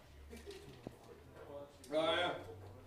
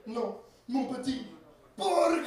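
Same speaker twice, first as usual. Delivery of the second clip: hammed-up French wail, high and loud; mock French hero; 9 semitones higher, 6 dB louder than usual. Dying girlfriend in his arms, hero wails in mock French. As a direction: overdo a weeping French hero. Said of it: "It's like".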